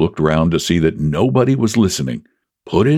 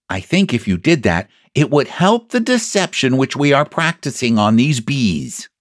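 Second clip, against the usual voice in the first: lightly voiced bear, pitched up; scheming Pooh; 5 semitones higher than usual. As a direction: explain the plan earnestly in a raised bear voice. Voices Pooh giving instructions.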